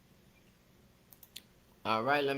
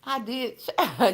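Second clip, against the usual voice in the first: mock excuse; 4 semitones higher than usual. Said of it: "so she could go over there and say".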